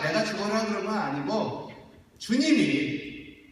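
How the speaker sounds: ordinary delivery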